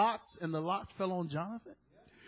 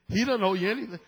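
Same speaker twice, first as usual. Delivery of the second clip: ordinary delivery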